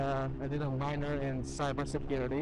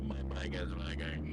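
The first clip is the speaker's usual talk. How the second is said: very deep voice; 14 semitones lower, 4 dB quieter than usual. Pretend. Low repeat of the line.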